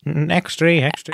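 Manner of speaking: lightly voiced thirties paperboy; newspaper boy. Selling papers on the corner, a hint of paperboy cry. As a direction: call it out like a street paperboy. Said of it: "talked like a newspaper boy".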